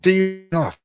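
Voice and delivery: loud, mocking retold scoff; mocking boss. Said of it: "he laughed at me saying".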